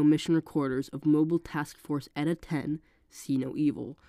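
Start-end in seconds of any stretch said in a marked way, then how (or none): none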